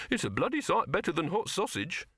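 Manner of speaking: high, defensive character voice; indignant demand. A narrator's reading. Silverfish defending his posters, high and huffy. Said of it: "Silverfish demanded".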